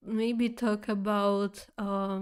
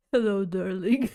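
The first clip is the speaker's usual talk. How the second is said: raspy voice; 5 dB louder than usual. Retold or imitated. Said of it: "someone saying with the raspy voice".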